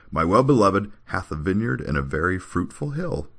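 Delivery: composed, lilting declamation; straight-faced scripture reading. Acted. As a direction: recite the verse without laughing. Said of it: "try to read this without laughing".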